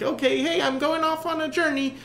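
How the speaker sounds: high, eager cry of setting off; excited departure. Pretend. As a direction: sound thrilled to start the voyage. Rower voiced setting off.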